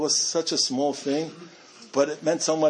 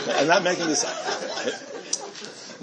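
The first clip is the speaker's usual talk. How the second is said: laughing aside; 2 semitones higher, much slower than usual. Real, not acted. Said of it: "laughing aside".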